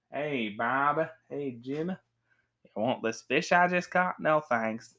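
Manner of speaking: cowboy drawl for a Western skit; mock Western accent; Western style. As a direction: play it like a hammy Western. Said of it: "Western style".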